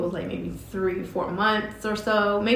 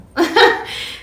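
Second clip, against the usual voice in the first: high, loud burst of laughter; big rising laugh; 11 semitones higher, 10 dB louder than usual. Real, not acted. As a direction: laugh out loud at your own boldness. Laughs after naming a non-negotiable salary.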